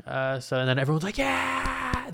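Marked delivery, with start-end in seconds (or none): 1.2-2.1 very high, long mock cheer; imitated crowd cheer. Crowd going crazy, imitated.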